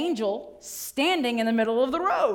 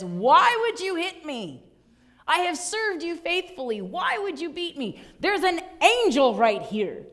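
pleading donkey; 6 semitones higher, 3 dB louder than usual. Acted. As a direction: plead with the prophet as the wronged donkey. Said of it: "the donkey speaks to the prophet and says".

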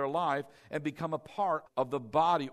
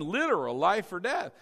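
ordinary delivery

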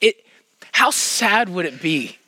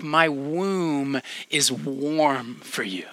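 tender quote; 6 semitones lower, 6 dB quieter, much slower than usual. Retold or imitated. Quoting a tender Hebrew saying.

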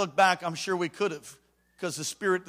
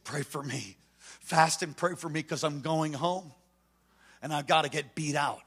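subdued plea; 6 semitones lower, 7 dB quieter than usual. Retold or imitated. Graduate to friend before going home, subdued plea. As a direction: quietly ask for help, imitating. Crying graduate pleads for prayer.

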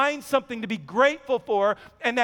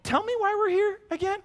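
smirking doctor; 10 semitones higher than usual. Retold or imitated. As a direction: mimic the smirking surgeon, much higher. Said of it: "and he says".

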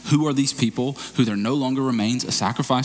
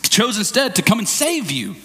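much higher, exasperated scolding; outraged rebuke; 7 semitones higher than usual. Acted. Spirit of grace grabbing us by the collar, outraged exasperated scolding. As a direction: shake sense into a foolish friend. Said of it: "The Spirit of grace is outraged and grabs us by the collar and goes".

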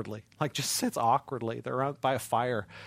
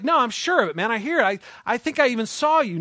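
put-on insistent girl; 7 semitones higher, 6 dB louder than usual.

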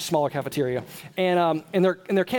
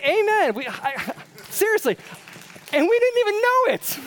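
much higher, louder exclamations; excited amen; 5 dB louder than usual. Real, not acted. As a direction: burst out with excitement.

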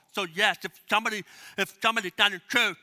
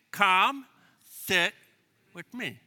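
leading recitation; much slower than usual. Shown as a demonstration. Pastor having the congregation repeat the invitation, deliberate. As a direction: lead the crowd through it in pieces. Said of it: "Say it again".

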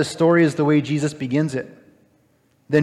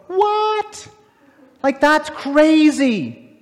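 squeaky, much higher "What?" then slow "that's crazy"; shocked disbelief; 10 semitones higher, much slower than usual. Acted. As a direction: play the outraged listener. Preacher reacts with mock astonishment.

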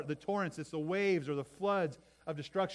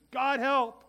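high loud cry of help; desperate shout; 9 semitones higher, 9 dB louder than usual. Shown as a demonstration. Preacher showing the simple cry, shouted high. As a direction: yell it as an emergency. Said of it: "a difference between saying".

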